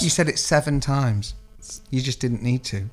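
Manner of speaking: slow, subdued telling-off, falling away softly; quiet, measured parental let-down; disappointed. Acted. Mum scolding him over the swearing, disappointed. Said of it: "just a really disappointed look".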